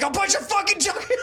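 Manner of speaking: mock threat. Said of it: "He's like".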